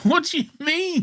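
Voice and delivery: high squeak of indignation; very high, amused protest; baffled protest. Real, not acted. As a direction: squeal it in playful self-defence. Protests being teased again.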